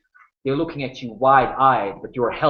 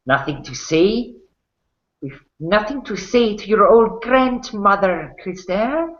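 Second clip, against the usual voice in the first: mocking, higher sneer; lightly voiced half-elf taunt; 9 semitones higher than usual. Half-elf taunting Krister about his grandmother, high sneer. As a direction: needle him with false sweetness.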